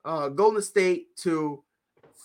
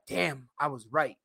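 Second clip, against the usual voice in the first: slow, low, soft damn; quiet resigned; 5 semitones lower, 7 dB quieter than usual. Retold or imitated. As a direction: sigh it out, resigned. Retold inner thought.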